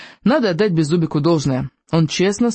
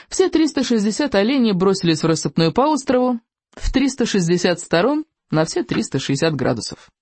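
ordinary delivery

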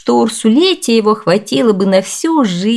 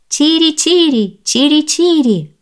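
high, slow chirped call; chirping bird; 9 semitones higher than usual. A narrator's reading. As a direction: chirp it out slowly and high, drawing out each call. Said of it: "громко защебетала птичка".

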